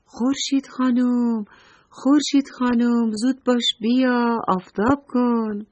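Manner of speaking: high singsong summons; calling out together. A narrator's reading. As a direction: call to the sun like a crowd of children. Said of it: "با همدیگه خورشید خانم رو صدا زدن".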